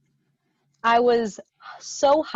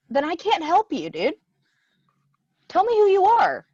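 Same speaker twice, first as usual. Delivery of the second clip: raised, high-pitched demand to a caller; exasperated retort; 8 semitones higher than usual. Retold or imitated.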